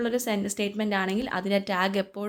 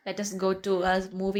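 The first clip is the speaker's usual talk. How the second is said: slow dictation; much slower than usual. Shown as a demonstration. Teacher dictates example slowly.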